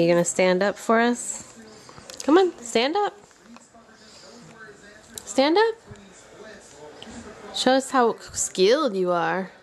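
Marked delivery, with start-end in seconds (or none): 2.2-5.7 high encouraging coax. Real, not acted. Coaxing toddler to stand.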